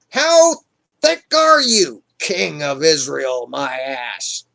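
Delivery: exasperated insult. Retold or imitated. Samuel fed up with Saul, exasperated.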